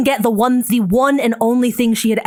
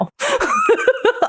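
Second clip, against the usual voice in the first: burst of high laughter; 13 semitones higher than usual. Real, not acted. Laughing at friend's old reaction.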